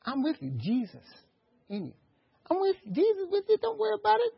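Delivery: soft, high-pitched reassurance; lifted, softened comforting voice; Holy Spirit. Acted. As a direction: gently hush someone's fear in a high, light voice. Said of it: "But the Holy Spirit said".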